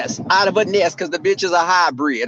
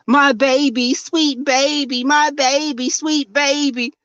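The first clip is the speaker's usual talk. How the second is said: slow, sugary cooing, higher; mock syrupy sweetness; 5 semitones higher than usual. Mocking Tracy's fake sweet talk to viewers, slow and cooing. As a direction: coo it like fake Southern candy sweetness. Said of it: "and all this".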